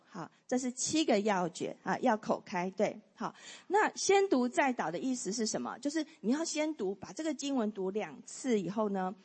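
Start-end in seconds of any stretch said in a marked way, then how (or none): none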